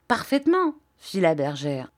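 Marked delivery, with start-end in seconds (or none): 0.1-0.8 higher, pointed Parfaitement; sharp high agreement; higher emphatic take. Acted. Same word said twice.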